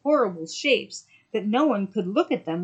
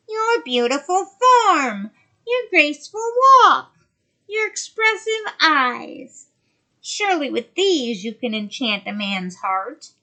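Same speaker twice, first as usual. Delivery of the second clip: loom over her as the sea witch; 6 semitones higher, 8 dB louder than usual. Acted. Witch keeps speaking.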